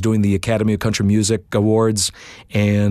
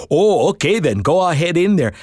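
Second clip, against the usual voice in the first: Canadian clerk; 8 semitones higher than usual. Retold or imitated.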